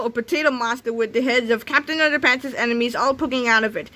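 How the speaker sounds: plain reading voice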